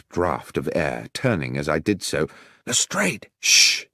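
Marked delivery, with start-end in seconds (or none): 2.6-3.3 high-pitched hushed exclamation; startled recognition. Acted. 3.4-3.9 sharp hissed "shh"; forceful shushing; urgent shush. Acted. Policeman hushes the startled doctor.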